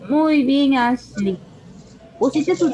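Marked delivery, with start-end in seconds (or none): none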